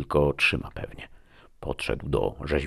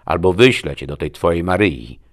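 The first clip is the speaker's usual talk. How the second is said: loud, snappish threat; loud warning with a touch of a snarl; 2 semitones higher, 8 dB louder than usual. A narrator's reading. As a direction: threaten with raised volume. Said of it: "warknęła Destiny".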